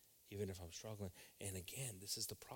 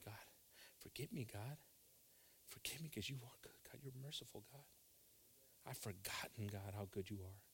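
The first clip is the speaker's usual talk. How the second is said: hushed whispered plea to God; tearful repentance prayer; 8 dB quieter than usual. Acted. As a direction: pray softly, almost breaking.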